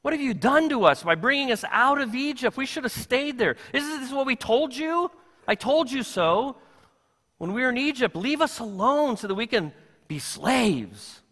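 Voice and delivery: high, whiny reproach to Moses; complaining Israelites. Retold or imitated. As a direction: scold Moses like a crowd that wants to go back. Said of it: "then they go to Moses, say".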